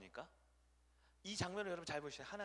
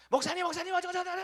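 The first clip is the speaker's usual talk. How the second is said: high, loud rush of complaint; urgent barrage; 13 semitones higher, 13 dB louder than usual. Retold or imitated. Worship team leader storming into the pastor's office, loud and high. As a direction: burst in talking over everyone.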